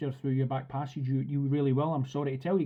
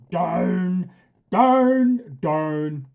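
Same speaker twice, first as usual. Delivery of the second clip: loud, harsh "Down, down, down." rising in the middle; repeated barked command; 4 semitones higher, 10 dB louder, much slower than usual. Retold or imitated. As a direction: bark it over and over like a frustrated owner. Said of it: "it's always".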